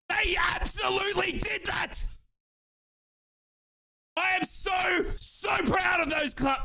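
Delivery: high, full-throated fan joy; elated celebration. Real, not acted. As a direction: burst with pride at the win, pitched high. Celebrating team's win live.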